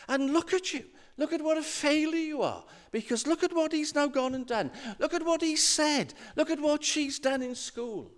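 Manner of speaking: high, quick-fire scolding; accusing inner voice. Acted. Little voice blaming a parent for the children, scolding. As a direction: rattle off failings in a high nagging pitch. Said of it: "this little voice will say".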